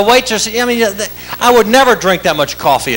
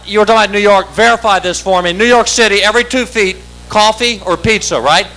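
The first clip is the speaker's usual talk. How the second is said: ordinary delivery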